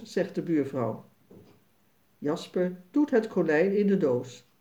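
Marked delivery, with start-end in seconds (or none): none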